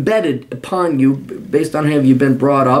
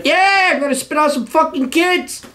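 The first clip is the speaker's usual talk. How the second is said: much higher, put-on mocking voice; mocking a Mexican immigrant in a much higher voice; mocking caricature voice; 14 semitones higher than usual. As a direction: sneer it out in a high put-on caricature.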